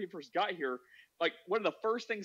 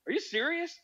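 very high incredulous question; acted amazement; 12 semitones higher than usual. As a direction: imitate a friend's mind being blown. Acting friend's amazement.

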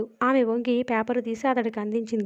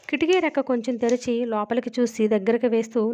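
ordinary delivery

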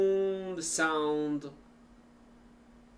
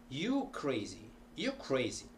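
"crazy" falling each time; statement intonation; 4 dB quieter than usual. Shown as a demonstration. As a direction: state it, dropping the end of "crazy". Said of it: "Means you are crazy".

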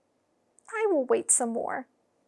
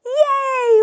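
excited cheer; 13 semitones higher, 9 dB louder than usual. Acted. Child cheers for surprise.

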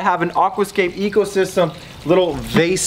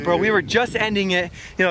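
ordinary talk, nothing marked

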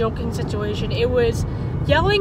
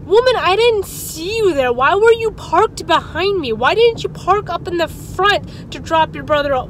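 exasperated; 5 semitones higher, 4 dB louder than usual. Retold or imitated. Retorting to the screaming mother.

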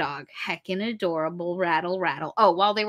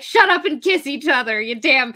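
louder, frustrated shouting at the characters; exasperated yell; 5 semitones higher, 7 dB louder than usual. Retold or imitated. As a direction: yell at the couple to kiss. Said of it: "And I was like".

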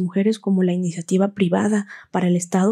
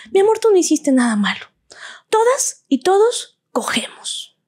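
mother's voice; 10 semitones higher than usual. Retold or imitated. Mother's iconic tearful line.

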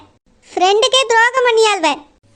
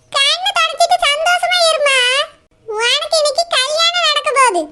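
higher, soothing mother's voice; comforting mother; 5 semitones higher than usual. Acted. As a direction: soothe your crying girl. Mother consoles crying daughter. (அழும் மகளைத் தேற்றும் அம்மா, மென்மையாக)

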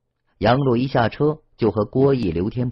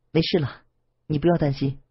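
higher, light laugh through tears; forced smile; 6 semitones higher than usual. A narrator's reading. Wang Tong reassuring Lan Feng after crying, forced light laugh. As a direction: brush it off with a brave little laugh. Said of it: "脸上露出一丝笑容".

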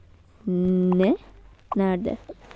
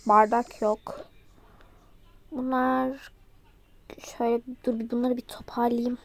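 soft unvoiced whisper; whispered to herself; 5 dB quieter than usual. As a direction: murmur it while busy. Muttering while tidying.

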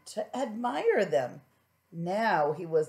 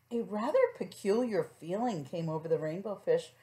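ordinary delivery

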